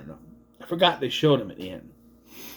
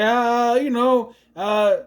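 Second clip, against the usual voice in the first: high, carefree shrug of a voice; lightly voiced careless captain; dismissive captain; 5 semitones higher than usual. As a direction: play it like nothing matters. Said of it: "He's like".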